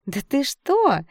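high, drawn-out mock astonishment; exaggerated, insincere surprise; fake surprise. A narrator's reading. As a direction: exclaim with false amazement. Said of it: "фальшиво удивилась Шленская".

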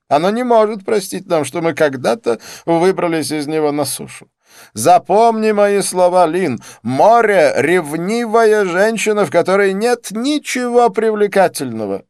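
high, loud theatrical declaiming; melodramatic, high-pitched proclamation; impassioned tirade. A narrator's reading. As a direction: declaim it like a grand warning. Didier rants about the jealous sea.

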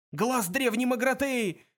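high, loud, drawn-out cry; yelling. A narrator's reading. Zaphod hears the voice of Magrathea, yelling. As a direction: cry it out at full excitement. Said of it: "завопил Зафот".

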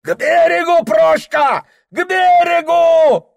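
high frantic shout, slow on the last words; frantic yell. Acted. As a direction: bellow it in wild alarm. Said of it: "неистово завопил черкес". (неистовый вопль)

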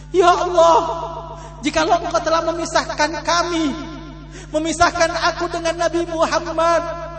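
high, loud, wailing prayer to Allah; old mother's lament. Acted. Old mother prays aloud to Allah, high and wailing. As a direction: cry it out like a grieving mother begging God.

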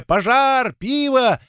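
high, drawn-out cries; crowd's outcry. A narrator's reading. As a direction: call out like an alarmed crowd. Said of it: "многоголосо выкрикивая".

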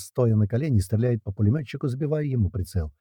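usual speaking voice

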